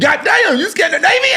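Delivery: loud, high shout of fake amazement; hammed-up disbelief, yelling it out; mock shock. Teasing a friend about his Scandinavian name, mock-shocked shout.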